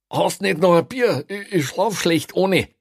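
pleading prisoner. A narrator's reading. Prisoner asking the policeman for beer, pleading. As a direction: plead for a favour, pitched high and loud.

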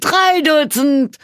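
"drei Dutzend" high, loud and slow, "drei" stretched; drawn-out jeer. A narrator's reading. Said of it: "johlten die Zuschauer".

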